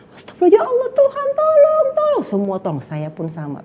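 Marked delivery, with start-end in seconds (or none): 0.1-2.2 high, panicked imitated plea; high mock scream for help; frightened cry for help. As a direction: cry out to God in terror. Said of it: "kita teriak dong".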